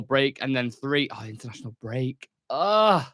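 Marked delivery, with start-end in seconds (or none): 2.5-3.0 loud, high groan of dread; exasperated groan. Real, not acted. Football fan dreading the international break, loud exasperated groan. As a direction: groan in open disgust.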